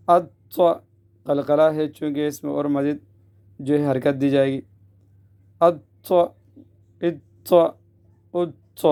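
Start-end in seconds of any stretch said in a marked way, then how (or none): 0.1-0.6 firm grip on the emphatic t; doubled heavy t. Shown as a demonstration. Teacher demonstrates doubled letter, gripping the t firmly. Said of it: "مشدد حرف کو سختی سے پکڑنا". 5.6-8.9 grip and release the t; firm held t before the vowel; doubled heavy t. Shown as a demonstration. Teacher drills doubled letter, gripping the t firmly. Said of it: "مشدد حرف کو سختی سے پکڑنا".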